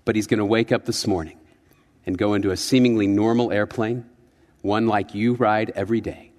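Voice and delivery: a deep, ominous TV host setting up the tale; Rod Serling. Retold or imitated. Preacher imitates the Twilight Zone host's intro, low and hushed. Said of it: "he'd go".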